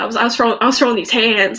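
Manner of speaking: normal talking voice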